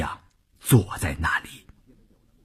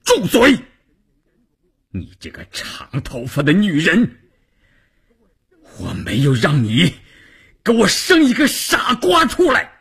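panting rage; 10 semitones higher than usual. Acted. Husband silences wife and blames her for their son, panting fury. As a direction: build to an enraged shout, short of breath. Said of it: "关山林气吁吁地说".

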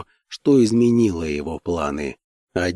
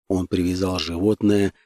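ordinary delivery, nothing marked

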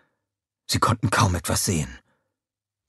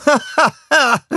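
high, loud scornful villain laugh; mocking laugh; 7 dB louder, slower than usual. A narrator's reading. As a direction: cackle in triumph. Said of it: "lachte Scarlett höhnisch".

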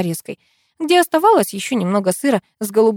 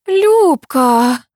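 high, loud gasp of a name; exhaled exclamation; 7 semitones higher, 6 dB louder than usual. A narrator's reading. Katya moved by the ring, exclaiming on an outbreath. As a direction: breathe out the name, higher and louder. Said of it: "выдохнула Катя".

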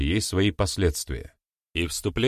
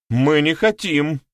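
loud, slow, higher protest; exclaimed refusal; 8 semitones higher, 6 dB louder, slower than usual. Acted. The women refuse their new chores: loud exclaimed protest. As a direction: cry out in stubborn refusal. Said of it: "воскликнули они хором".

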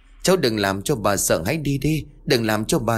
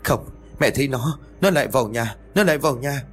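terrified insistence; 4 semitones higher, faster than usual. A narrator's reading. Terrified mother sees ghost.

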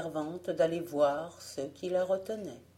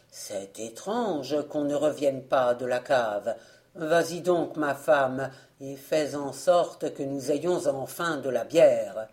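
voix grave et forte du père; il envoie sa femme à la cave, agacé; père impatient; 4 semitones lower, 4 dB louder than usual. A narrator's reading. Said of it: "disait le père". (le père agacé, voix grave et forte)

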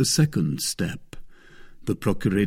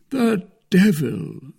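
slow, high-pitched musing exclamation; gloating Musketeer; 10 semitones higher, 4 dB louder, slower than usual. A narrator's reading. Porthos thinks as he walks away, musing. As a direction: draw it out to yourself.

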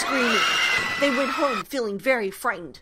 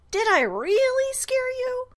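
much higher, louder gleeful squeal; delighted ghost squeal; 5 dB louder than usual. Acted. Ghost Elizabeth squealing with delight at Paul. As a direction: squeal with glee at your own trick.

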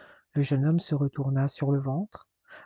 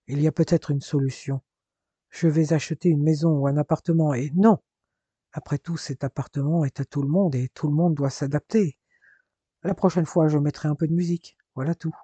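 ordinary delivery

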